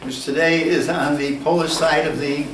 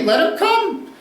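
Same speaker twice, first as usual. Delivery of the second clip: a hint of a breezy capitalist, pitched higher; lightly voiced capitalist, upbeat and higher; mock capitalist; 7 semitones higher than usual. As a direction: toss it off like a confident capitalist. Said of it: "Capitalists would say".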